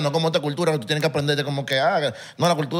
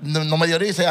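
usual speaking voice

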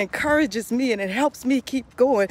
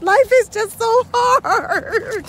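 higher, slow, laughing lament; 10 semitones higher, much slower than usual. Real, not acted. Joking to viewers that life is hard, laughing. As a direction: stretch it out through laughter.

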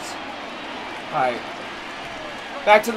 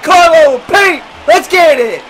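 celebratory shout; 3 semitones higher, 4 dB louder than usual. Real, not acted. Gamer praising Carlo and Pete, celebratory shout. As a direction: cheer the team off the field. Cheering own team.

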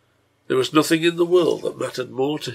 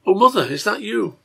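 high, loud startled question; agitated child; 9 semitones higher, 7 dB louder than usual. A narrator's reading. Waking sick girl calls to her mother, agitated. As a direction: wake up crying out in a child's pitch.

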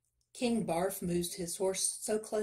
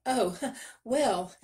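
higher, louder, laughing stall; nervous laugh; 5 semitones higher, 5 dB louder than usual. A narrator's reading. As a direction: laugh nervously while buying time. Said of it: "the miller laughed nervously".

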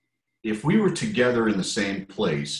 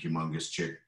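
ordinary delivery

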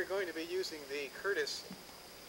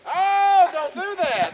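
high, loud startled cry; frightened yelp; 12 semitones higher, 15 dB louder, much slower than usual. Real, not acted. Scared on shaking tower.

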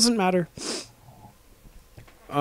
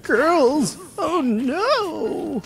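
much higher, squealing mock voice of the nerd; mock excited nerd; much slower than usual. Imitating the action-figure guy's squeal at girls. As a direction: gush like a boy who spots girls. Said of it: "he's just like".

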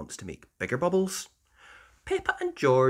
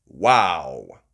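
wow; 8 semitones lower, 7 dB louder than usual. Acted. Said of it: "says everyone".

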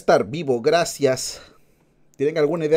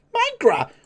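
excited high exclamation. Pretend.

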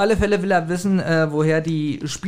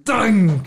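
imitated ring; 6 semitones higher, 4 dB louder than usual. Imitates phone ringing in story.

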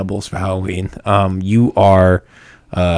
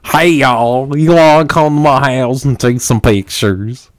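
higher, louder cowboy drawl; cowboy accent; 6 semitones higher, 5 dB louder, slower than usual. Retold or imitated. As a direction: ham up a Texas cowboy twang. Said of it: "you just sound like a cowboy".

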